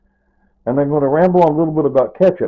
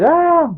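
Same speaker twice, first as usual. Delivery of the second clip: anguished cry; 6 dB louder than usual. Real, not acted. Grief outburst after wife's death.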